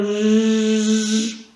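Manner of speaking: j held very long as zhhh; isolated letter sound. Shown as a demonstration. Teacher asking pupils how to write it, long held j. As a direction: stretch j into one continuous "zh" buzz. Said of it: "comment est-ce que je vais écrire le".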